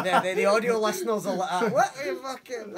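high, loud laughing speech; laughter bursting through the words; real laughing. Real, not acted. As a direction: crack up as you talk. Cracking up over audio listeners' confusion.

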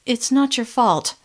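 slow, trembling reassurance; shaking voice. Acted. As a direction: hold back fear as she reassures. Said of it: "the shaking in my voice".

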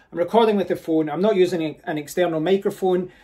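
usual speaking voice

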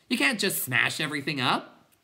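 screamed protest. A narrator's reading. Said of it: "Bastian screamed".

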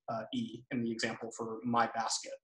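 ordinary talk, nothing marked

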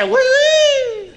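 very high, silly impression of the French; mock French squeal. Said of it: "Yeah, they say".

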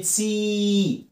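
"سي" drawn out as "seeee"; high, sustained "سي"; long E demo. Shown as a demonstration. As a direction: hold the vowel in "سي". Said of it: "اعملها لونج إي".

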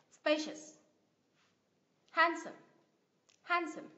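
each word from "Happy" to "Handsome" strong at the start, then falling; first-syllable stress drill. Shown as a demonstration. Teacher drills adjectives for learners to repeat, first syllables stressed. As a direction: model "Happy" to "Handsome" one by one, first syllable punched, with pauses. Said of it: "Các bạn đọc lại nhá".

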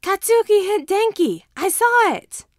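put-on high, loud little boy telling on a friend; excited child's shout; loud child tattling. A narrator's reading. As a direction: shout it out like an eager five-year-old.

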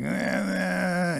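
high drawn-out unintelligible slur; slurred drunken Paul. Retold or imitated. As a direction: imitate an old drunkard mumbling without real words. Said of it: "hard to understand him when he talked".

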